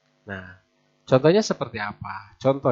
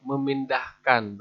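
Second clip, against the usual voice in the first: usual speaking voice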